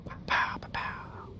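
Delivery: whispered pow pow effects; whispered pow sounds. Pretend. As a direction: breathe out soft gun noises. Whispered gun noises.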